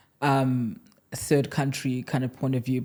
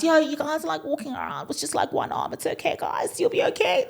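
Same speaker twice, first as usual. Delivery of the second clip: mocking voice; 13 semitones higher than usual. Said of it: "that's what I've been referenced".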